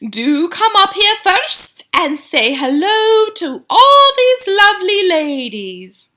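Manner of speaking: high, slow, sugary witch voice, fading to a whisper; syrupy witch. A narrator's reading. Grand High Witch sweetly coaxing the boy onto the platform. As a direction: coax sweetly like a witch luring a child. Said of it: "soft and gentle and absolutely dripping with syrup".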